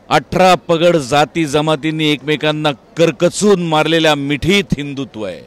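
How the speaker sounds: loud, declaimed film speech with weighty pauses; dramatic film dialogue. Acted. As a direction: deliver it like a rousing trailer line, forceful and measured. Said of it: "त्यातला dialogue Pravin सरांचा फार अप्रतिम dialogue".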